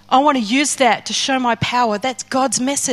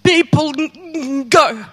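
acted stammer; much slower than usual. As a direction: stutter on "let", dragging it out and breaking down. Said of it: "I have a stammer".

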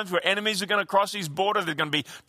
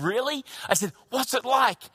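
retold eager question; 6 semitones higher than usual. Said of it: "with great anticipation".

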